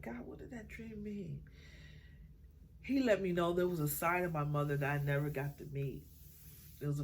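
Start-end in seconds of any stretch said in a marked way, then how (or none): 0.0-1.4 soft wondering. Retold or imitated. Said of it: "I was like".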